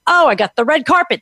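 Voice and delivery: ordinary talk, nothing marked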